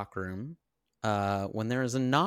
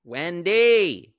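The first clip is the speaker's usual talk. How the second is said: loud mock call of Wendy; yelled name; 9 semitones higher, 10 dB louder than usual. Husband bellowing for his wife Wendy, loud mock call. Calling out as Jack.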